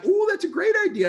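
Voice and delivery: a very high, loud burst of delight; light up at a new idea; excited discovery. Pretend. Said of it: "maybe you're like".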